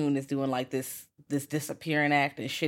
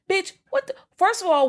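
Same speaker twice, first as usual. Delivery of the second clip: shocked squeal; 6 dB louder than usual. Real, not acted. Shocked outburst at the demon.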